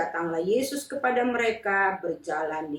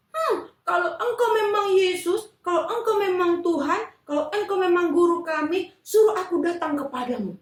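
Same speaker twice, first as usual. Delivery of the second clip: pleading Peter; 9 semitones higher, 3 dB louder than usual. Acted.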